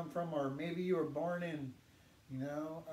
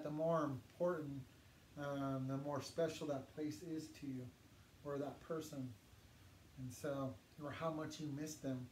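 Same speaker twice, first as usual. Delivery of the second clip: ordinary delivery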